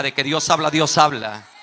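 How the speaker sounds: loud, shouted proclamation to the congregation; shouted preacher's refrain; emphatic preaching repetition. Real, not acted. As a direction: drive the refrain home at full force. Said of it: "Se lo voy a volver a repetir".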